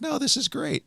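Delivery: high, light mimicked reply; retold reassurance. Daughter reassuring her musician father, high mimicked reassurance.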